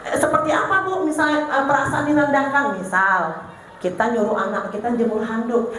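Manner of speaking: usual speaking voice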